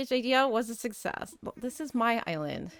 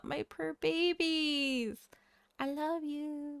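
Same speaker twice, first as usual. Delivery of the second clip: cooing; 5 semitones higher, 6 dB quieter, slower than usual. Real, not acted. Cooing at villagers hidden by dialogue.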